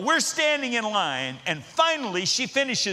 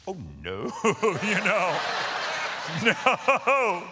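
laughing groan; much slower than usual. Retold or imitated. Bystanders groan at rude kid.

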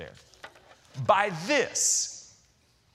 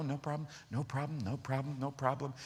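soft, low reassurance; comfortable, unbothered; 3 semitones lower, 9 dB quieter than usual. Retold or imitated. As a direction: murmur it soothingly, completely unruffled. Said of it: "She handled it very comfortably".

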